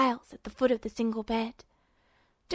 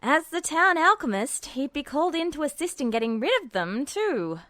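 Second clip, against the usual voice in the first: sarcastic; 3 semitones higher than usual. A narrator's reading. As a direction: sneer lightly at the alchemist. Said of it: "Edward added sarcastically".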